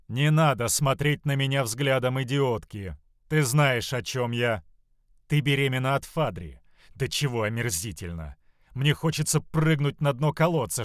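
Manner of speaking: loud, outraged mother berating her daughter; acted furious reproach; disgusted scolding. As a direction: shame her with rising disgust.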